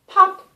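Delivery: a sharp, high, loud popping sound; loud pop. A narrator's reading. Narrator voicing the magic pop, sharp and loud.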